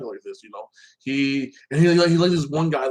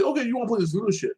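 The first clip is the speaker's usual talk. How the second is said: taunting; 8 semitones higher, faster than usual. Retold or imitated. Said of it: "if Andy's like".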